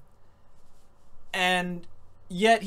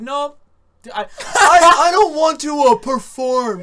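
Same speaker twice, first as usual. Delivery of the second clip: higher, louder, laughing mock refusal with a slow last word; mock David refusing; 6 semitones higher, 11 dB louder, slower than usual. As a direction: ham up David backing out. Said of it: "he was just like".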